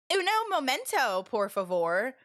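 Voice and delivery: mock American accent in Spanish. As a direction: imitate her heavily Americanized Spanish. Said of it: "can't even say it the way she".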